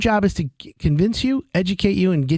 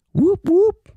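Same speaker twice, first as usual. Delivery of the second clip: high, loud mock siren whoop; mock alarm whoop; 4 dB louder than usual. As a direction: sound a playful warning siren. Alarm at voided warranty.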